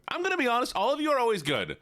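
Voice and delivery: sweet high voice, fast; gushing to the chat; high sweet praise. Real, not acted.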